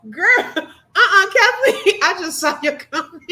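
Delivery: can barely get it out for laughing. Real, not acted. Streamer reading Kathleen's comment, laughing hard. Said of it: "you know I cry".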